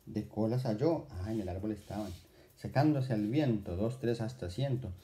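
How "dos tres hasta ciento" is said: usual speaking voice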